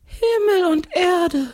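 very high, slow ghostly cry; ghost calling out. A narrator's reading. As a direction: draw it out high like an exclaiming ghost. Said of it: "ruft der Geist". (gedehnter, hoher Geisterruf)

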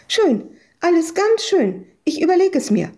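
high-pitched, blurted brush-off; hurriedly blurted out. A narrator's reading. Ewke to the tenant, panicking to get out, blurted out. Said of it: "stieß Ewke hervor".

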